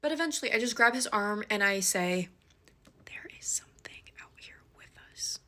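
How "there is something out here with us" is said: unvoiced tense whisper to him. Whispering a warning to her date in the dark woods. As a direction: whisper the warning so no one else hears. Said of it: "I made sure to whisper it".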